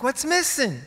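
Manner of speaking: desperate to know; eager question. Pretend. Asking God eagerly.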